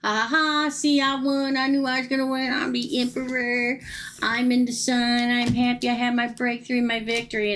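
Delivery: high-pitched boastful crowing, held up throughout; mock a man blowing his own horn; gloating. Tarot reader voicing him telling his friends he won, gloating. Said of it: "he's gonna be, like, blowing his own horn".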